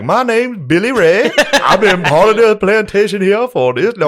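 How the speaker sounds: high, loud put-on voice, laughing through it; mock reenactor voice. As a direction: play the cheerful reenactor in character. Reenactor introduces himself, high voice.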